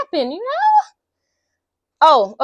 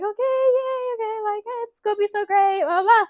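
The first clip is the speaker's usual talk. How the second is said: gushing excitement; 9 semitones higher than usual. Retold or imitated. Writers gushing after the meeting.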